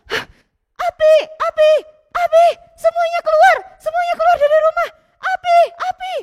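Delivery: high, loud panicked yelling; fire alarm shout. Acted. Villager shouting to everyone that there is a fire, panicked. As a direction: warn the whole village to get out.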